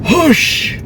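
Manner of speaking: high loud whoosh of a lift; whoosh sound. Retold or imitated. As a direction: whoosh her up like a rag doll. Husband lifts her up.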